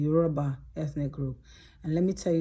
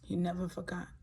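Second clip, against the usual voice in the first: real emotion taking over the voice; emotional; 3 semitones higher, 6 dB quieter, faster than usual. Real, not acted. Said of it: "Why am I emotional?".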